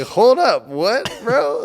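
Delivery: high, loud hold up bro; stunned disbelief. Retold or imitated. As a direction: jaw dropping at a statue. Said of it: "where you're just like".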